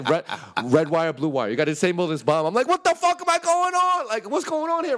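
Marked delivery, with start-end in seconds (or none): 2.7-5.0 high-pitched, wound-up yelling; mock desperate outcry; acted frantic panic. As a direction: reenact losing it under pressure. Panicking as if defusing a bomb.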